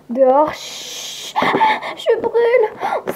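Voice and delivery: lent, aigu, gémissant; brûlure douloureuse. Acted. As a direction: geint de douleur au soleil. Vampire brûlée par le soleil. (plainte aiguë de brûlure)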